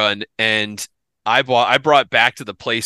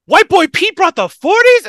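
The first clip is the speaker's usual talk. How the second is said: much higher, louder incredulous cry; disbelieving question; 7 dB louder than usual. Retold or imitated. Guests at the barbecue reacting to Pete, loud incredulous shout.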